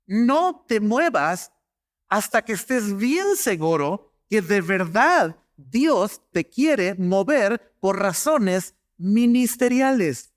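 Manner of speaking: each word slow and deliberate; slow, earnest pulpit emphasis; slow solemn warning. Acted. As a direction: lay the warning down slowly, weighing every word. Preacher stresses key warning to congregation.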